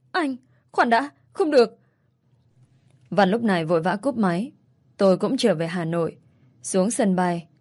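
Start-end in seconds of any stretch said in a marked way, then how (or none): 0.1-1.8 panicked call before he hangs up; desperate plea. Acted. Mother begs ex-husband not to take her daughter, desperate cry. Begging him not to hang up.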